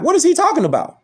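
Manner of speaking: high-pitched, exasperated questioning; incredulous challenge. Real, not acted. Disputing the played clip.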